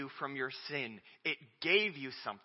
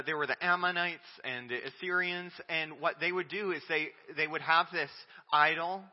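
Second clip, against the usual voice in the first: ordinary delivery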